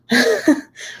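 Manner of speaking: short high giggle; laugh. Real, not acted. Laughing at own complaint.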